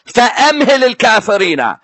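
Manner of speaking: فَأَمْهِلِ said fast as "fa-amhili" with no doubled h; mistaken quick recitation. Shown as a demonstration. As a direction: clip the opening into فَأَمْهِلِ, hurried. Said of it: "His accent was saying it so quick".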